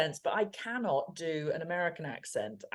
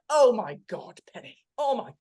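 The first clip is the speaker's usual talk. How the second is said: appalled mum; 3 semitones higher than usual. Retold or imitated. Voicing her appalled mum.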